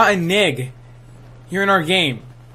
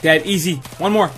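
usual speaking voice

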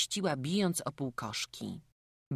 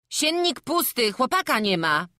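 put-on high, loud, dismayed boy; acted high-pitched alarm; alarmed report; 10 semitones higher, 9 dB louder than usual. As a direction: cry out the bad news to your father. Son reports empty bed.

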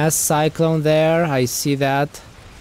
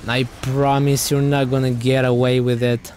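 ordinary delivery

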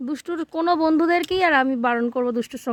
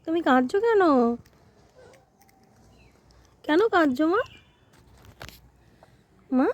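put-on coaxing a toddler. Mother coaxing her sulking little girl to look up, gently. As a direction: soothe her and win a look back.

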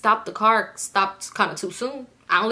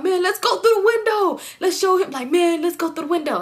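high, excited mock urging; hyped egging on; 9 semitones higher than usual. Boy rallying friends to climb through the window, high hyped urging. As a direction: imitate a rowdy friend, pitched way up.

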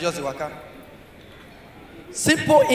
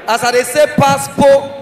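ordinary talk

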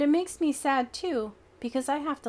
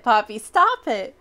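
yell; 8 dB louder than usual. Acted. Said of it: "I yell".

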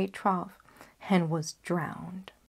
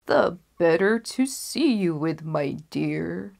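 a lightly voiced wolf, a touch louder; sly wolf; 4 dB louder than usual. Disguised wolf answering the girl, sly and a bit louder. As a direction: purr it like a wolf hiding in a granny's bed.

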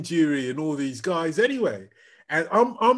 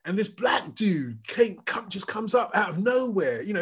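ordinary delivery

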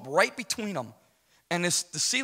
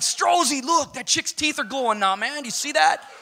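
much higher, louder excited urging; excited call; 10 semitones higher, 5 dB louder than usual. Retold or imitated. He urges Strozzi to see the glowing teeth, high and excited. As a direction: grab your buddy's attention. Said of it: "And I'm like".

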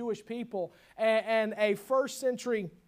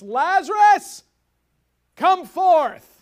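much louder and higher, slow commanding call; loud cry; 10 semitones higher, 15 dB louder, much slower than usual. Acted. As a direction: call him out of the grave at full voice. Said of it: "he cries with a loud voice".

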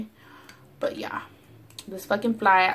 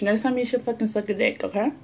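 ordinary delivery

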